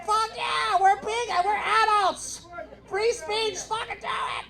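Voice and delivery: high, squeaky mock audience voice. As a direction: squeak it like a giddy crowd. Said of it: "And you said".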